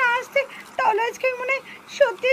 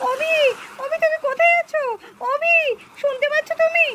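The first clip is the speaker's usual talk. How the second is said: calling for help; 4 semitones higher than usual. Acted. Buried wife calls for husband.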